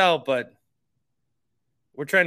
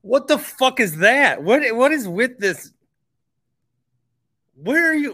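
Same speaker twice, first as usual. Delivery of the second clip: loud, high, slowed disbelief; stunned outburst; 5 semitones higher, 5 dB louder than usual. Real, not acted. Host shocked by caller's insult, loud disbelief. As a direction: blurt out real shock at the caller.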